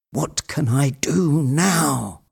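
high, slow, drawn-out question; upset, trembling. A narrator's reading. Legrand at a loss after bad news, upset. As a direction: ask it slowly in upset helplessness. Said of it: "his voice was trembling".

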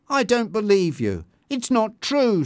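high, emphatic disbelief; indignant, pitch climbing; upset denial. A narrator's reading. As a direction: protest it cannot be true. Bianca denies the accusation.

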